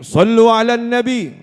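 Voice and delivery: loud, high shout rallying the crowd to salawat; high-pitched, full-voiced call to prayer. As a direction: cry it out high and loud to a whole gathering. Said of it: "kok ada yang teriak".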